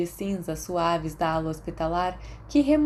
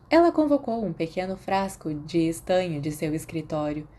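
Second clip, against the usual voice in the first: ordinary delivery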